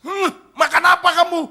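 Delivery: high, loud mock outburst; appalled parent. Parent hears child wants to be pastor, shouted mock alarm.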